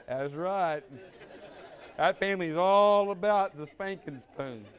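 laughing, slow agreement; laughing reply. Retold or imitated. Father answering his daughter in the car, laughing. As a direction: chuckle through the answer. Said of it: "I said".

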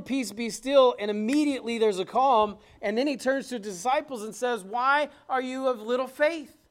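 loud, high-pitched fervent preaching; preaching at full force. Acted. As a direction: drive the story home to the congregation. Preacher retelling the storm.

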